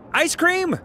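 very high-pitched, pleading question; hopeful offer. Acted. Rejected suitor offering a treat, high hopeful plea. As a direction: squeak out one last offer.

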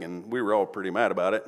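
usual speaking voice

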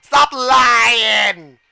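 loud, accusing shout; exasperated shouting. Real, not acted. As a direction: yell it at a liar, building. Yelling at lying game character.

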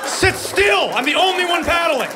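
high-pitched frantic dad yell; exasperated shout. Acted. Dad to kids rocking the canoe, exasperated shout. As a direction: shout it high, acting at wit's end.

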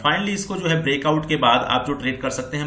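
usual speaking voice

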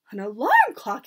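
loud incredulous question; cried out. A narrator's reading. Witches reacting to the secret, cried out. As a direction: echo it loudly in disbelief. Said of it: "they cried".

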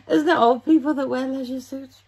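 giggling through the question; real laugh spilling into the words; laughing at herself. Real, not acted. Laughing at her own leisure suit, amused. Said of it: "I'm just laughing at myself".

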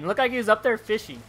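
ordinary delivery

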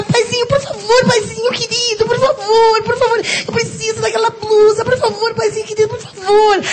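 high whiny begging to father; begging daddy. Retold or imitated. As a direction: sweet-talk dad for the blouse. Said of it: "Aí eu faço pro meu pai".

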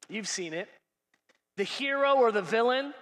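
ordinary talk

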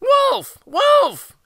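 high, loud cry of alarm; boy's high-pitched alarm call; crying out in false alarm. A narrator's reading. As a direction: call out shrilly to the villagers as the prankster boy. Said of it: "he cried".

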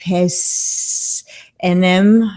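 "recipes enem" sounded out haltingly; careful, uncertain attempt at "recipes enem", syllable by syllable; stumbling pronunciation. Shown as a demonstration. Host trying a live chat member's Latin name, "recipes enem" sounded out unsurely. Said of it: "I got that wrong too".

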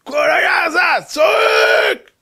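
high, drawn-out dramatic cry; wailing shout. Acted. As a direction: stretch it into a slow, high wail. Storyteller acts out the screaming. (צעקה מיללת וממושכת)

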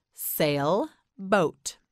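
"Sailboat" with a clear gap between sail and boat; split in two. Shown as a demonstration. Teacher models a word for learner, "Sailboat" split in two. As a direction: break "Sailboat" into two slow separate parts. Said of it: "You say it".

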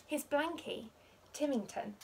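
usual speaking voice

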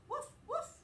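high, bouncy woof woof; playful dog bark. Acted. Teacher barking for Biscuit to the kids, high and playful. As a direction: bark like a happy puppy.

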